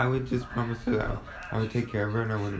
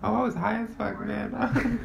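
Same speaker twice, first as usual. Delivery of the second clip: higher, laid-back stoner reply; mock stoner voice; 10 semitones higher, slower than usual. Retelling answering her brother's question, mock stoner. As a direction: brush it off like a happy stoner. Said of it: "And I said".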